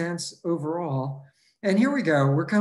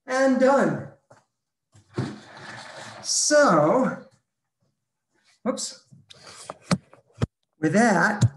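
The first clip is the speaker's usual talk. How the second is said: breathless after the workout; panting between words; out of breath; 4 semitones higher, 4 dB louder, slower than usual. Real, not acted. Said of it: "I'm out of breath".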